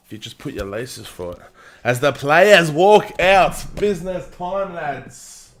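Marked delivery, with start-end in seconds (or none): 1.8-5.5 commentator call. Real, not acted. Players walking out, announcing.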